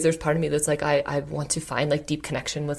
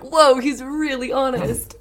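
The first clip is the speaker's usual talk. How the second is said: drawn-out, higher imitation of a shocked crowd; mock astonishment; 8 semitones higher, 4 dB louder, slower than usual. Woman imitates the circle's shocked reaction, amused astonishment. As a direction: stretch it out in amused awe.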